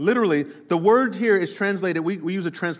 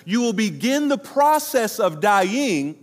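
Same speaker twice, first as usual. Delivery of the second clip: slow solemn build; 2 semitones higher, slower than usual. Shown as a demonstration. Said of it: "He's literally saying".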